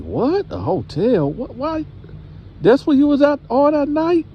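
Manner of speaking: high, shocked grandma voice; hammed-up disbelieving grandma.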